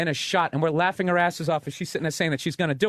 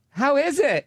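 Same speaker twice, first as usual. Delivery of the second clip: high, louder mock question; piercing stripper voice; 9 semitones higher, 4 dB louder, slower than usual. Stripper asking about his beer, high mock voice. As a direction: squeal it up high. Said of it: "had a voice that literally could break the glass".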